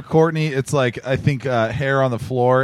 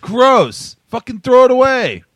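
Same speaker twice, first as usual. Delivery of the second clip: loud, high, grossed out; disgusted; 8 semitones higher, 5 dB louder, slower than usual. Real, not acted. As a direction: spit it out in disgust. Disgust at dead batteries.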